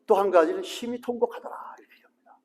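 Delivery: hushed whisper for the weeping; whispered aside to the congregation. Acted. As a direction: breathe it out, almost silent. Quoting weeping prayer, whispered.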